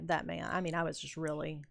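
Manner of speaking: ordinary talk, nothing marked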